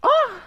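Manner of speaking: a short high shriek of shock; startled shriek. Real, not acted. Streamer yelps when the call cuts out. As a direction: yelp in sudden shock.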